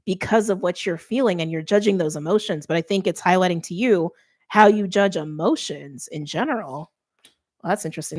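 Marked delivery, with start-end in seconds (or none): none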